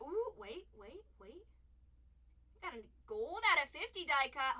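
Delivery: ordinary talk, nothing marked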